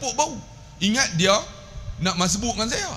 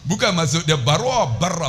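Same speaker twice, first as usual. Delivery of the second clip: normal talking voice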